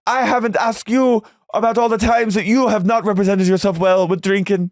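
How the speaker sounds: high-pitched, exasperated mock Irish wail; Irish accent, Shannon. Shannon protesting Tamara's drinking questions, high mock Irish accent. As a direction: rant in a high put-on Irish lilt. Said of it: "She's spiritually Irish to me".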